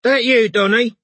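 high, loud London neighbour call. A narrator's reading. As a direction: shout through the wall. Neighbour calling through wall.

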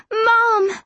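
loud, high-pitched scream for Mom; desperate high cry. A narrator's reading. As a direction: scream out for your mother. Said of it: "I screamed into the wildness".

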